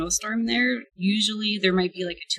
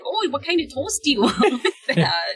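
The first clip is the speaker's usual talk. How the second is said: put-on chirpy Minnesota accent, higher, laughing through it; laid-on Minnesota accent; 8 semitones higher, 4 dB louder than usual. Breakfast server asking a customer about toast, mock Minnesota accent. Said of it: "I got a laid on thing".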